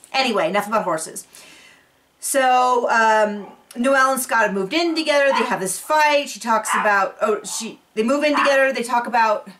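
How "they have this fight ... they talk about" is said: ordinary delivery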